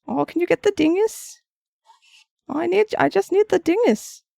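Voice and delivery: mock old lady wanting the dingus; imitating grandma. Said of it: "my grandmother called everything a dingus".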